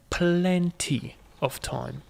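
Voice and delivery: slow, stretched-out "plenty"; drawn-out assurance. Real, not acted. Player reassuring self about the clock, drawn out. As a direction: draw the word plenty right out.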